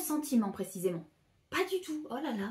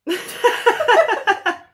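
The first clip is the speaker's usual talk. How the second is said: high loud laughter; 10 semitones higher, 12 dB louder than usual. Real, not acted. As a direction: burst out laughing.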